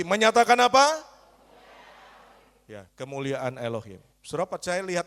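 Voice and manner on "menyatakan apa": much louder, pushing the question out; louder call. Shown as a demonstration. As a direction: shout it back at them, louder. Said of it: "Lebih keras lagi".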